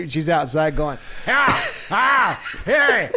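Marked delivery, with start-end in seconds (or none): 1.3-3.1 high mock yells at bears; short, high-pitched hollers, played for laughs; imitated yelling. Woman shooing bears off outside, mock yelling. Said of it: "I'm not gonna yell at bears anymore".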